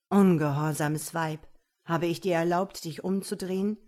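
loud, indignant reprimand; stern rebuke. A narrator's reading. Man rebukes woman for turning round, stern and loud. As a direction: scold as the outraged one in command. Master scolds a disobedient woman.

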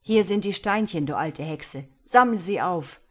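loud defiant call. A narrator's reading. Boy scattering gems before the old witch, loud defiant call. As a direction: fling the words at the witch. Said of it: "rief der Junge".

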